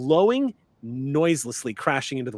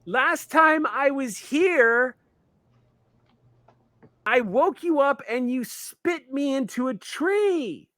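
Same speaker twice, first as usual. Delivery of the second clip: panicked pleading; 11 semitones higher, slower than usual. Acted. Ameep panics before the mountain spirit, high pleading. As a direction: backpedal fast, scared of the giant.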